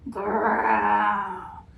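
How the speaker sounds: low grumbling bear growl; grisly growl. A narrator's reading. Grumpy bear growling at the animals, a low growl. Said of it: "he would give a grisly grumble".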